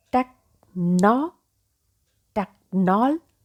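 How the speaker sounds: "technology" in parts, tek, NOL, jee, with pauses; broken into syllables. Shown as a demonstration. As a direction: build "technology" up piece by piece. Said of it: "So how will you pronounce?".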